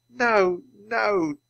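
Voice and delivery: tearful. Acted. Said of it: "As Teddy wept".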